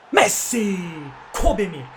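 high, loud celebration; excited cheer. Real, not acted. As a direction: hype up the dribble. Celebrating a dribble.

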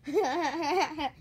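acted snake cackle; steady "ha ha ha" chuckle at normal pitch; villain's laugh. Mocking staccato laugh. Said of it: "Laughed the snake".

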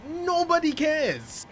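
high, drawn-out exasperated whine; slow, high-pitched fed-up complaint. Real, not acted. Rant about bus announcement.

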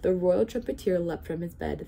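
usual speaking voice

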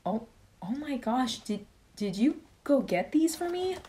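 high, breathless realisation; retold happy disbelief at the gift; dawning delight. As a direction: it finally clicks he bought them. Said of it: "that's when I was like".

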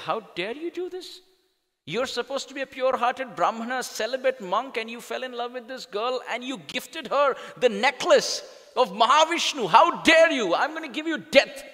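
high, loud raging rebuke; furious king. Acted. Enraged king condemning the priest, furious shout. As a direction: roar the sentence down at him.

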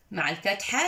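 ordinary talk

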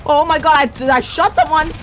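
shocked. Real, not acted. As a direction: blurt it in alarm. Hit a teammate by accident.

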